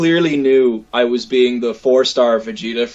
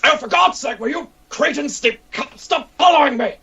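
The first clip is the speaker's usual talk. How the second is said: higher, exasperated Vegeta voice; irritated Vegeta; 9 semitones higher than usual. Acted. Vegeta cosplayer scolds fans trailing him, exasperated rant. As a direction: scold the cretins following you. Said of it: "and I'm like".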